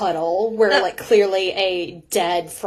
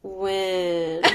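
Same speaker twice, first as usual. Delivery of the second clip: soft, dragged-out deadpan chant; unenthusiastic; 5 dB quieter than usual. Acted. Co-host as the girls answering mom, unenthusiastically. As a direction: cheer with zero enthusiasm. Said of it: "The enthusiasm is not in the room".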